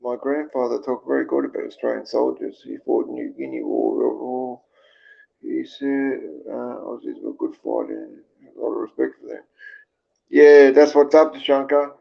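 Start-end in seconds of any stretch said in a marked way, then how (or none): 0.0-9.4 much softer, low-key reading to oneself; quiet, subdued read-out; reading comments aloud. Real, not acted. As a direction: murmur through the comments on screen. Reads viewers' comments.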